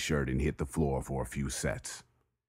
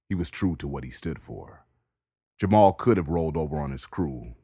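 ordinary delivery, nothing marked

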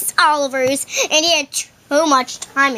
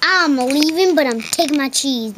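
ordinary talk, nothing marked

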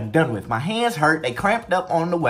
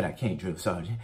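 quiet, lower, sheepish answer; meek reply; 9 semitones lower, 9 dB quieter than usual. Retold or imitated. Recruit answering the drill sergeant, meek and quiet. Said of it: "I said".

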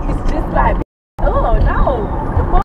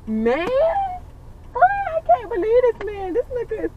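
disbelief; 10 semitones higher, 3 dB quieter, slower than usual. Real, not acted. Disbelief at his behaviour.

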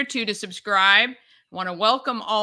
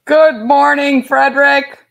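loud, muffled behind a plastic mask; muffled through a mask; 5 semitones higher, 12 dB louder than usual. Real, not acted. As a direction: call out through a Halloween mask. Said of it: "I can't breathe in this thing".

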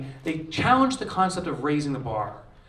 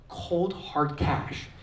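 slow emphasis; 4 dB quieter, much slower than usual. Real, not acted. Savoring first profit.

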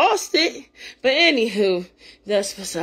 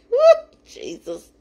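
joyful laugh; 5 semitones higher than usual. Real, not acted. Laughing with joy.

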